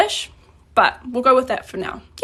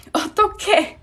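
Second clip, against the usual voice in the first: dismayed exclamation; 8 semitones higher, much faster than usual. Shown as a demonstration. Exclaiming in dismay, then laughs.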